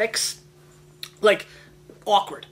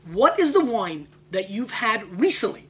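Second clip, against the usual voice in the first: ordinary talk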